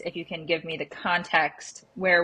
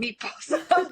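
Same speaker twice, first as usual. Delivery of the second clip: laughing; 8 semitones higher, slower than usual. Retold or imitated. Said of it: "you started saying".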